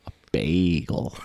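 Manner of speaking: "bagel" with a drawn-out long A; pointedly overdone "bagel", starting like "bay". Shown as a demonstration. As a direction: stretch "bagel" into "BAAAY-gul". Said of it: "It's a long A".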